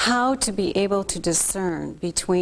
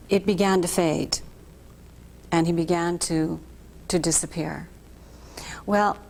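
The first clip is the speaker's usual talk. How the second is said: usual speaking voice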